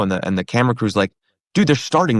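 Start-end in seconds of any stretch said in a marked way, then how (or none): 1.5-2.2 higher-pitched, pressing warning; urgent. Retold or imitated. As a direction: hurry a friend along, pitched up. Camera crew urging him on air.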